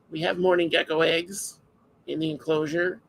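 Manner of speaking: ordinary talk, nothing marked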